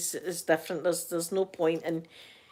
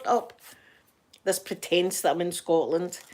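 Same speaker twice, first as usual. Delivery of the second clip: usual speaking voice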